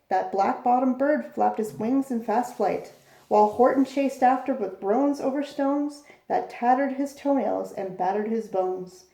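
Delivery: ordinary delivery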